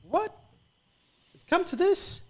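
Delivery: high, rising disbelief; shocked it has come to this; a squeaked indignant what; incredulous what. Retold or imitated. David's disbelief, retold.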